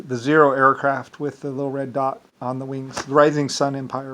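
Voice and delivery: usual speaking voice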